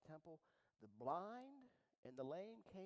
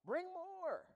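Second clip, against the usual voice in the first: high, loud call; imagined rejoicing shout; joyful shout; 14 semitones higher, 10 dB louder than usual. Pretend. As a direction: shout for more.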